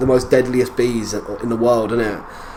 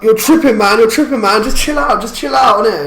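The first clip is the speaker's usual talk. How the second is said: higher and louder, calming a mate who's lost it; calming a mate, raised voice; 9 semitones higher, 7 dB louder than usual. Retold or imitated. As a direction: call out to settle him down, loud and high. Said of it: "And he was like".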